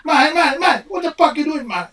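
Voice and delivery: Aussie mate drill. Shown as a demonstration. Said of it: "If you can play that word".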